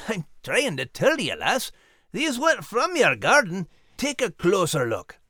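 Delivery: soft Scottish dog being coy; sheepish, then coaxing; lightly Scottish Max. Acted. Max voiced in home scene.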